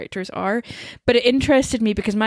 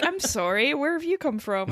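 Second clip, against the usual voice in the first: puzzled polite locals; 5 semitones higher than usual. Retold or imitated. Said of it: "They're just like".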